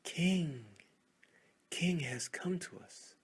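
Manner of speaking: high, loud cry of joy; joyful shout. Acted. The crowd hails the arriving king joyfully. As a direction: greet the king with delight. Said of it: "The crowd shouted in joy".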